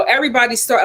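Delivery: ordinary talk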